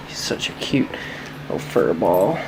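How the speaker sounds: soft, low, breathy coo after a sigh; tender coo. Real, not acted. Owner coos softly, low and breathy, over her cute puppy. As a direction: melt over the little fur ball.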